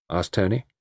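ordinary delivery

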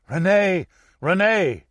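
loud anguished cry of a name. Acted. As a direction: call out to a dying friend. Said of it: "cried Antoine".

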